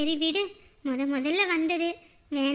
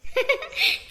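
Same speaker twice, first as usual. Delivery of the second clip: high laugh; 11 semitones higher than usual. Real, not acted. Laughs at daughter-in-law.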